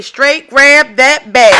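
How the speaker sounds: slow, loud call of straight grab that bag; call and response. Pretend. Vlogger leading her viewers in a chant, loud and slow. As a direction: belt it out for the crowd, slow. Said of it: "Can somebody say".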